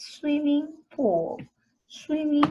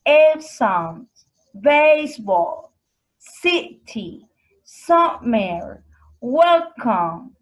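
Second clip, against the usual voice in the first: example words said loud with a strong first beat; stressed syllables; 5 dB louder, slower than usual. Shown as a demonstration. Teacher gives stress examples to the class, each word punched. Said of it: "the first syllable is usually stressed".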